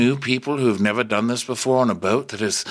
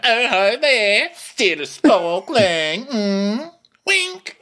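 high, slow, sing-song waitress voice; sitcom diner waitress; 11 semitones higher, 3 dB louder, much slower than usual. Retold or imitated. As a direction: serve it up like an eighties TV waitress. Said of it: "like a diner waitress on an eighties sitcom".

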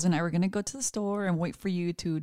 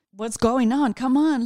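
mock impatient outburst; 4 semitones higher, 10 dB louder than usual. Imagined snapping at stressed husband.

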